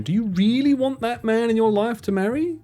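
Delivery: usual speaking voice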